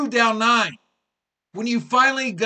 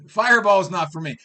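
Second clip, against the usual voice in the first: usual speaking voice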